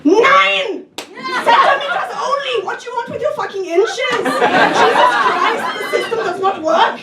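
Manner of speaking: mock outrage. Comic to husband Alfie, mock outrage at inches. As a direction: scoff at imperial units in mock fury. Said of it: "little outburst".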